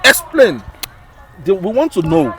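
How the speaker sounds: high, loud demand; insistent demand. Real, not acted. Host wanting to know how women do it, loud demand. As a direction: call out for an explanation.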